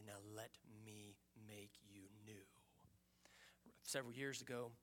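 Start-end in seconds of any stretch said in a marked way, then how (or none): 0.0-2.4 slow, hushed whisper. Acted. Pastor voicing God's promise in a slow whisper. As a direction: breathe it out slowly as God. God speaks tenderly, voiced by pastor.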